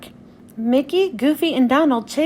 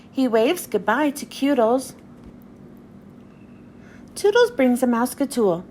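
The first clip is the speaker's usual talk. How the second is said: usual speaking voice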